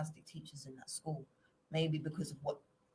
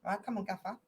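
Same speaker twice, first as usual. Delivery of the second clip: South African accent, mock bossy master; 4 dB quieter than usual. Shown as a demonstration. Showing off his South African accent, a lightly bossy master. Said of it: "my South African accent".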